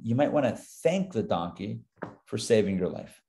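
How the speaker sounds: ordinary delivery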